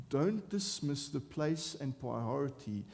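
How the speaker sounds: normal talking voice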